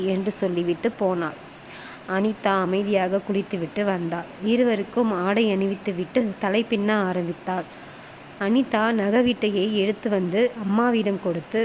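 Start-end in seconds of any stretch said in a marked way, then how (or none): none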